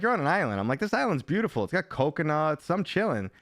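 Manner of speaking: high, breezy voice of himself in the game; acting out the game. Streamer acting out his carefree island start. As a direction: play it like you're chilling on an island.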